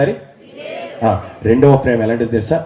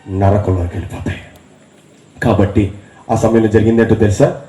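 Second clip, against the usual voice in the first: ordinary delivery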